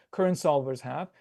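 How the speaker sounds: ordinary talk, nothing marked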